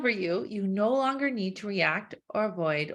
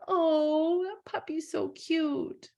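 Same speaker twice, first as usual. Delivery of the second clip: cooing at a puppy; 6 semitones higher, much slower than usual. Acted. Reacting to a cute puppy picture.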